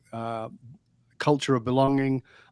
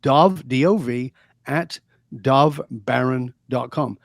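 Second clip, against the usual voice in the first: slow, spelled-out email address; slow, careful dictation of an email address; spelled out slowly; slower than usual. Shown as a demonstration. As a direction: dictate each letter clearly and slowly. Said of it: "My email is my direct email".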